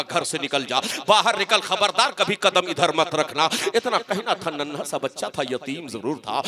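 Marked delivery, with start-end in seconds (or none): 0.0-3.5 loud stern order to get out; forceful, loud scolding; angry uncle. Acted. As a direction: throw the child out with raised voice. Uncle throws child out.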